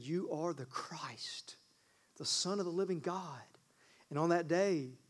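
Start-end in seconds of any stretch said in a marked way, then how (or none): 0.0-3.4 hushed confession. Acted. Peter confessing to Jesus in an awed whisper. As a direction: breathe it like a secret revelation.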